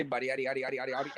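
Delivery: very soft, mumbled yaddy chant; mumbled quote. Retold or imitated. Imitating Rakeem's soft mumbled catchphrase. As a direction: mumble the nonsense words under breath. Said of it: "that boy Rakeem say".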